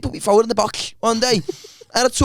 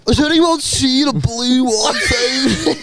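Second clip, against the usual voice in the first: imitating wandering drunk friend; 2 semitones higher, much slower than usual. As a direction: call it out very slowly, imitating a drunk mate. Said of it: "He said it exactly like that".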